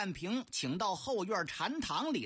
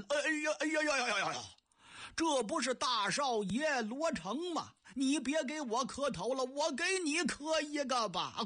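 put-on rapid, higher sarcastic old man's cry of surprise; hammed-up old man exclamation; 5 semitones higher than usual. Old Ding Yanping mocking Luo Cheng's kowtow, high sarcastic exclamation. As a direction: pile on the mock shock, then bow back sarcastically.

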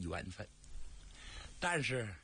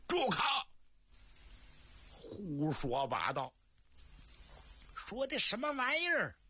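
sharp high angry shout at the matchmaker; furious outburst; 7 semitones higher than usual. Acted. Guan Tai leaps up and shouts down the matchmaker, furious shout. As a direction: snap at her in outrage.

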